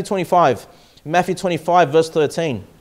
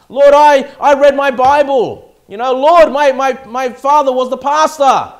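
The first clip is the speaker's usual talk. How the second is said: higher, louder, boasting of deeds to the Lord; self-righteous churchgoer; 7 semitones higher, 7 dB louder than usual. Acted. Preacher mocking a works-trusting member, loud boastful pleading. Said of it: "they're trusting on everything else".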